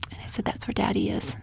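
soft breathy whisper of comfort; mother whispering comfort to her boys. Retold or imitated. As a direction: whisper it gently. Mother telling sons about Heaven.

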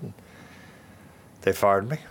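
usual speaking voice

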